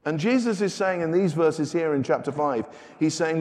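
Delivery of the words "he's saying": ordinary talk, nothing marked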